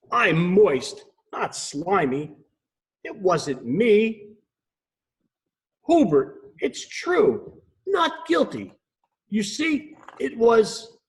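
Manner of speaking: high, indignant frog voice. Acted. Frog protesting to the owl detective. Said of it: "Freddie croaked".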